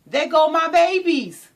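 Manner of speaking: high, loud shout of joy; joyful mother cheer. Retold or imitated. Proud mother cheering for her children, joyful. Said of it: "she's like".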